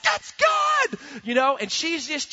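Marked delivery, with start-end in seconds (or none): 0.0-1.0 high, loud victory yell; triumphant shout. Retold or imitated. Son shouting after spiking the ball, triumphant. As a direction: whoop it after the touchdown spike. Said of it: "and he's like".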